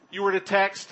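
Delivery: ordinary talk, nothing marked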